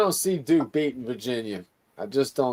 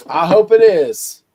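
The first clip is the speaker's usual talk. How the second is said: emphatic hope; 4 semitones higher, 10 dB louder than usual. Real, not acted. Repeats wish more emphatically.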